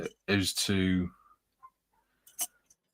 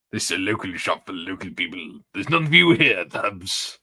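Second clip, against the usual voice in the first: Little Britain shopkeeper. Retold or imitated. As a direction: play the suspicious Little Britain shopkeeper who snaps at outsiders. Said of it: "Little Britain".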